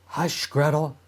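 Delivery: ordinary delivery, nothing marked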